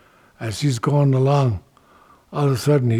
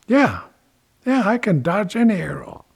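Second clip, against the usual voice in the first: high-pitched Dragonfly reply; cocky agreement; 7 semitones higher than usual. Acted. Dragonfly accepts, boasting of dodging arrows, cocky. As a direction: answer readily, pitched up.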